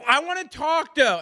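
high mock plea; mocking call. Teasing her, calling from the scooter in mock plea. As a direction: squeak it like a needy partner. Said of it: "you being on the Lime going".

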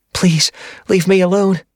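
high, frightened whimper. A narrator's reading. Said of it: "Mia whimpered".

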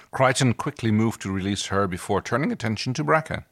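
usual speaking voice

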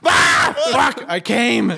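high laughing yell; laughing shout. Real, not acted. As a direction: blurt it out, laughing. Joke amid laughter.

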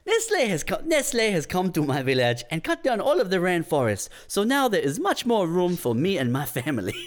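lightly high put-on voice; cocoa farmer character. Repeats the farmer character line.

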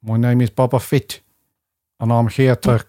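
lightly put-on South African accent; mock South African accent. Co-host doing a South African accent for Boba Fett. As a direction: try a District Nine style South African. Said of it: "my South African".

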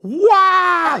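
very high, loud crowd scream; hammed-up shriek of a delirious crowd; audience screaming. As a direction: imitate a whole audience going wild. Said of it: "out of their seats screaming".

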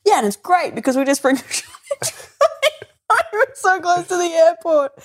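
high, giggling innocent delight; innocent naive glee. Retold or imitated. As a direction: laugh through the cheerful pride of it. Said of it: "the innocent naivety of, like".

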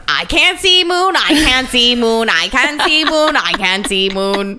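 put-on fixated little girl. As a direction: imitate a child stuck on one line. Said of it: "she just kept going".

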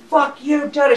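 mock yell, pitched well up; imitated angry screaming. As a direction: mimic her ranting without a full scream. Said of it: "she starts fucking screaming".

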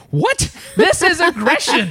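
high-pitched, laughing disbelief; mock incredulity. Traveler reacts to being called aggressive, mock incredulous.

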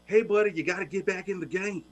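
a hint of a folksy Texan owner; half-hearted Jerry Jones impression; bad Texas accent. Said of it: "I'm gonna do my bad accent".

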